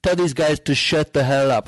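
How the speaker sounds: a light Trump voice on "Tell these guys to shut the hell up"; mild mock Trump bark; Trump impression. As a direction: quote him with a hint of his cadence. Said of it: "I'm not doing the impression".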